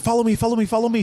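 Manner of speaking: high, insistent repeats; imitated urgent beckoning. Drunk lady herding them to the next bar, imitated urgency. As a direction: rattle it out high and eager. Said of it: "kidnapped us from one bar and said".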